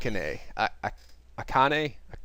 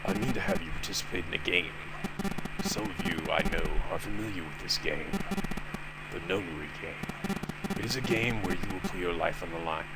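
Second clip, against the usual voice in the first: low menacing voice; 5 semitones lower, 6 dB quieter than usual. Acted. Zero explains the game.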